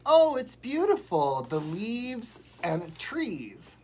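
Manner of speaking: gushing praise. Retold or imitated. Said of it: "and I was like".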